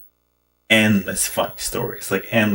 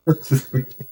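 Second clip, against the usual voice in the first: short laugh; 4 semitones higher than usual. Real, not acted. Laughing after the punchline.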